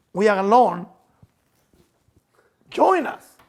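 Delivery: mock seductive invitation. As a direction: lure them in, hammed-up.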